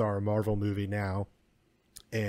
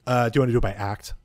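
normal talking voice

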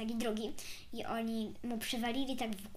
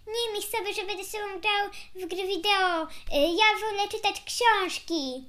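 louder, higher acted-out reply; retold boy's protest; 8 semitones higher, 7 dB louder than usual. Girl retelling a young boy's refusal to play, higher and louder protest. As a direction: mimic a boy insisting on his own way. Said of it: "a on tak powiedział".

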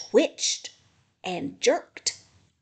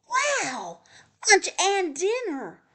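high, louder squeal of delight; excited screech; 9 semitones higher, 3 dB louder than usual. A narrator's reading. Hickory sees lunch and dinner, excited screech. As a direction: screech it like an excited storybook animal. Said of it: "Hickory screeched".

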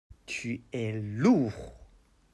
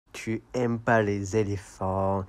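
normal talking voice

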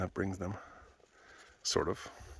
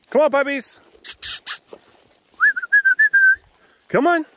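high, loud call to the dogs; yelling; 12 dB louder than usual. Real, not acted. Calling puppies to come along on a walk, yelling high. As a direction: call them in, pitched way up. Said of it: "Sorry for yelling".

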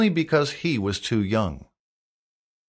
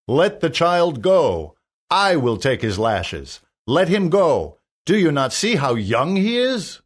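put-on louder, higher appeal for a child; urgent plea; 5 semitones higher, 5 dB louder than usual. A narrator's reading. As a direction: plead firmly for mercy. Hendon pleads for the child.

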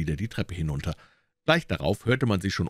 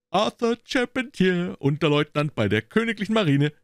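proud announcement; 12 semitones higher, 4 dB louder than usual. A narrator's reading. Gregson proudly naming the culprit to Holmes. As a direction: announce the name like a prize. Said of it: "rief er".

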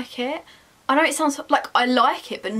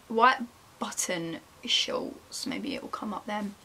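hushed unvoiced whisper; breathy murmur while shopping; 3 semitones lower, 6 dB quieter, slower than usual. Real, not acted. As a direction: whisper it to yourself.

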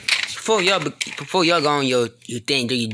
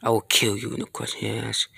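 normal talking voice

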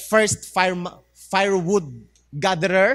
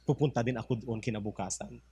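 scheming whisper; 8 semitones lower, 10 dB quieter than usual. Acted. Neighbor plots quietly.

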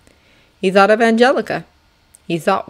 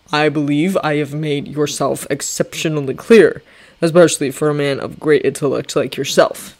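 lower, measured commanding general; General Washington; 3 semitones lower than usual. Acted. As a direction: speak like a calm stern general. Washington dismissive.

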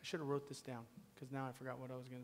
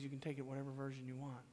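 ordinary talk, nothing marked

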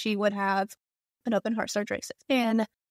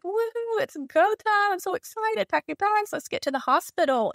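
much higher, bouncy mock-excited cheer; retold excitement; 10 semitones higher than usual. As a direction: replay that giddy go-time thrill. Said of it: "I was so excited".

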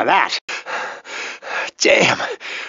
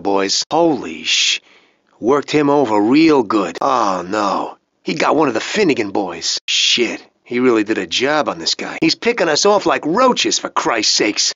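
usual speaking voice

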